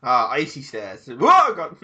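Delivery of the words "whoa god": surprised yelp. Real, not acted. Sudden slip on icy stairs.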